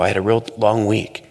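normal talking voice

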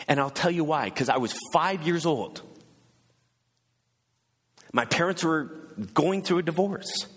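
high, ringing preacher's lift; rising sermon. Acted. Preacher rousing the congregation about Jesus, impassioned. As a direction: preach it out to the back rows.

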